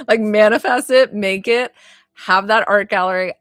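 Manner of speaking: rally her toward her dream; loud hype-up for the viewer; excited cheer. Real, not acted.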